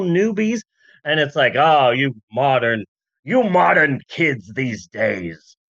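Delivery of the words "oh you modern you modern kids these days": lightly voiced old-timey grumble, lower and slower; a hammed-up old-fashioned man scolding kids; mock Walt Disney. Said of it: "that's not how Walt Disney sounds".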